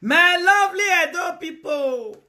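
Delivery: slow, high, loud calling out. Real, not acted. Hailing her Edo people on live video, slow loud call. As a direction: hail the crowd, slow and loud.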